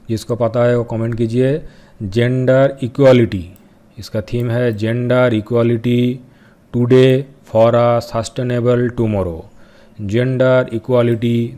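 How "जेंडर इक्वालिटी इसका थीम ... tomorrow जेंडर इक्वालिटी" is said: slow and louder, every word spaced for note-taking; slow dictation. Shown as a demonstration. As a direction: dictate it slowly so they can write it down. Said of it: "मैं धीरे से बोल रहा हूँ". (धीरे-धीरे और ज़ोर से, नोट कराते हुए)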